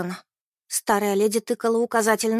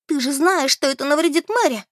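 higher-pitched angry reproach; 5 semitones higher, 4 dB louder than usual. A narrator's reading. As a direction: protest indignantly. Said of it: "разозлилась Бет". (сердитый упрёк)

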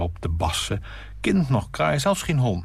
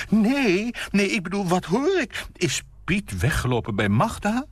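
eager gossip; 13 semitones higher than usual. Acted. Nina pressing her guest for gossip, eager and high. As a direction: lean in hungry for the news.

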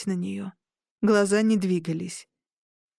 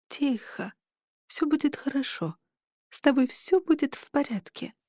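soothing hush; 5 semitones higher, 4 dB quieter than usual. A narrator's reading. Soothing the dying inn.

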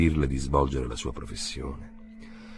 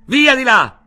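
very high, very loud shout; shout across the street; 12 dB louder, slower than usual. A narrator's reading. K yelling at the neighbours across the street, loud. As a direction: yell them away from the window. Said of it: "Gridò poi di là dalla strada".

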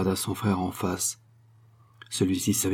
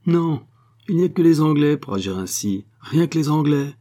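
higher and louder, offended lady ranting at the English; outraged Sophie; 5 semitones higher, 4 dB louder than usual. Acted. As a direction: build to a proud indignant outburst. (Sophie outrée, plus fort et plus haut)

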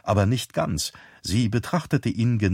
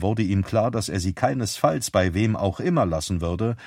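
ordinary delivery

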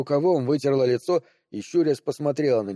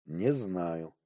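softer, low and withdrawn; very quiet; 6 semitones lower, 8 dB quieter than usual. A narrator's reading. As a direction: say it barely above a breath, lower. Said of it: "Теперь его голос звучал очень тихо".

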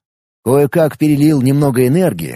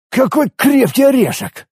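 high, strained grunt of effort; bursting out mid-fight; exasperated exclamation; 11 semitones higher than usual. Acted.

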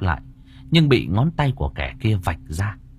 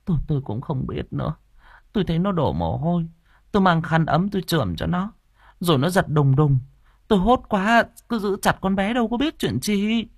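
high, worried account with a stammered start; anxious; 9 semitones higher than usual. A narrator's reading. Bà Hỏa tells the cook what happened, worried. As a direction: explain anxiously, pitch climbing as it goes. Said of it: "vẻ mặt lo âu".

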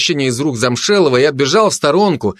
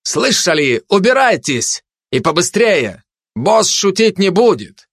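high, pushy calling out; restrained narrated shout; shouted order; 8 semitones higher, slower than usual. A narrator's reading. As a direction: order them off, voice raised in pitch. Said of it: "прокричал спецназу и туристу".

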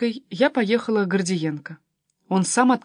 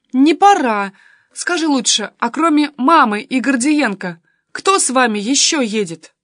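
put-on higher, louder girl refusing bedtime; lightly voiced insistent daughter; 5 semitones higher, 8 dB louder than usual. A narrator's reading. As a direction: push for an answer like a pert teen. (настырная дочка, повыше)

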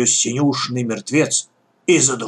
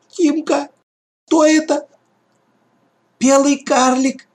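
put-on high, scared child cry; terrified whisper-shout in the dark; frightened; 13 semitones higher than usual. A narrator's reading. (испуганный крик)

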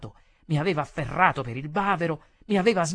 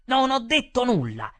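loud, high insistent denial; heated; 9 semitones higher, 6 dB louder than usual. A narrator's reading. Waiter repeating it while breaking free, heated. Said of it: "Io mi scaldai".

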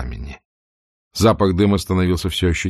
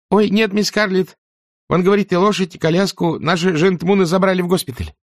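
put-on high, timid young servant girl; frightened maid voice; 13 semitones higher than usual. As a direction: squeak it out nervously. (испуганная служанка)

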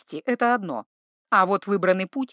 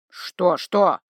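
gruff bark; 4 semitones lower, slower than usual. A narrator's reading. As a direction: snap it out angrily. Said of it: "рявкнул Грым". (грубый рык тролля)

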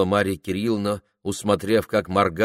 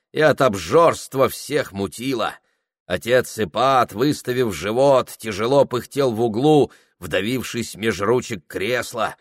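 plain reading voice

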